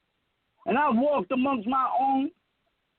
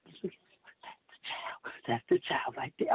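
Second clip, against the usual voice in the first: hushed, voiceless gossip whisper; 13 semitones lower, 9 dB quieter than usual. Retold or imitated. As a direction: whisper it like staring strangers. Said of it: "looking and whispering".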